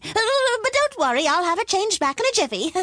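very high, hurried reassurance; flustered wizard gabbling to reassure; nervous wizard. A narrator's reading. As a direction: rush it out in a high, anxious wizard voice. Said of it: "the wizard said nervously".

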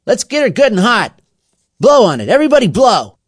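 high, loud rallying shouts; rally everyone at the fire; excited commanding shout; urging the gang. A narrator's reading.

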